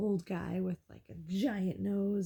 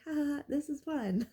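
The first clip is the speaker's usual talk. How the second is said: higher, airy fake cheer, pretending not to care; mock carefree laugh; 8 semitones higher than usual. To friends while missing every toss, mock carefree. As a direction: brush it off with a put-on chuckle.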